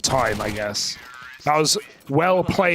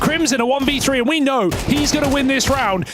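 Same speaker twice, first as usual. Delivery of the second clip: excited esports caster, higher pitch; punchy, high-energy match call; live play-by-play; 7 semitones higher than usual. Real, not acted. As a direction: drive the commentary to the round's climax.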